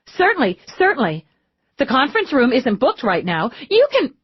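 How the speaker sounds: high, hurried obliging tone; eager to please. A narrator's reading. Receptionist hurrying to help the detective, eager. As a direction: rush to oblige the lieutenant.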